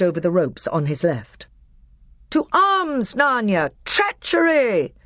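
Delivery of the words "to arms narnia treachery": high rallying call, drawn out at the end; shout. A narrator's reading. Peter calling Narnia to arms as men attack, high shout. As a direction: call your army to battle. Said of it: "Peter shouted".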